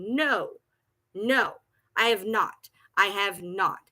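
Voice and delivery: normal talking voice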